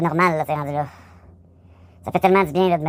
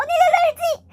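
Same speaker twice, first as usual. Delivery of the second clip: high laughing squeal; slower than usual. Real, not acted. Sudden high laughing outburst.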